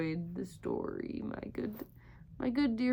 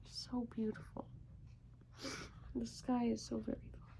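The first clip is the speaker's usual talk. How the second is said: hushed, breathless wonder; soft awestruck whisper; awed whisper; 12 dB quieter, faster than usual. Real, not acted. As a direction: whisper it at the stars. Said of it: "awed at the night sky".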